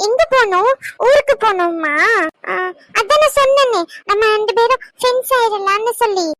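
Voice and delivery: high, sing-song chat to a little one; cooing baby talk. Acted. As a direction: coo it brightly as if to a toddler.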